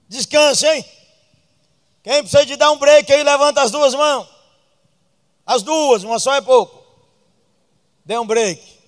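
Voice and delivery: loud, high preaching call; loud, high preacher's exhortation; preaching at full force. Acted. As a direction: rouse the crowd to raise hands. Pastor exhorts the congregation.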